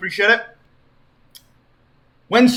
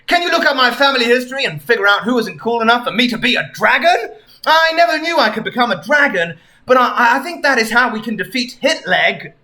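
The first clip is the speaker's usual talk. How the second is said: lightly voiced Sonic; 6 semitones higher, 3 dB louder, faster than usual. Sonic asks Tails.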